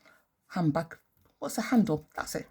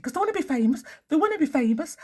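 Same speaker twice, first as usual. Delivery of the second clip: mocking fame-seekers; 10 semitones higher, 5 dB louder, faster than usual. Mocking fame-hungry MPs.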